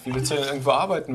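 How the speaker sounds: ordinary delivery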